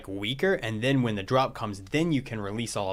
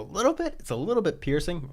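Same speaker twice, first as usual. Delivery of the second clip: voice crack; 6 semitones higher than usual. Real, not acted. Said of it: "did you guys hear that voice crack".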